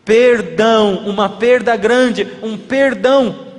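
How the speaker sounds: Perdão drawn out slowly, each word weighed; pregação lenta, marcando perdão e perda; slow emphatic stress. Acted. Preacher stressing forgiveness wordplay.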